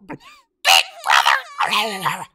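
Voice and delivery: eerie call. Acted. Ghost calling out.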